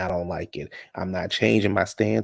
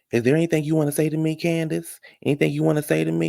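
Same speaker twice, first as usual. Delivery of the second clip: higher, fast taunting challenge; mimicking Deborah; 7 semitones higher than usual. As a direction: push her to say it to your face. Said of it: "You can hear Deborah saying".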